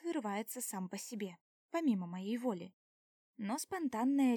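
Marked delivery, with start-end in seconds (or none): none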